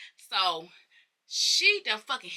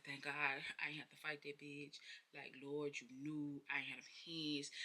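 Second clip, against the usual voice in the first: low, very soft thankful murmur; relieved aside; 5 semitones lower, 19 dB quieter than usual. Retold or imitated. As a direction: murmur it like a private prayer of relief. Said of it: "it was like a relief".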